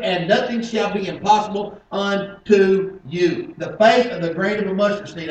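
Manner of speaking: high, forceful delivery, stretching out the closing words; preaching from scripture. Acted. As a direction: proclaim the verse to the congregation.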